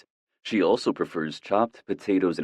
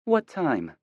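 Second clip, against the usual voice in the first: mother voice; 10 semitones higher than usual. A narrator's reading.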